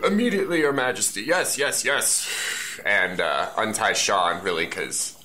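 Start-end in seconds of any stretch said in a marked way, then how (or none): 0.1-2.8 eager servants. Acted. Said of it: "ogre guards jump to".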